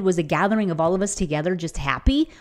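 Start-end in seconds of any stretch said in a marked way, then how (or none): none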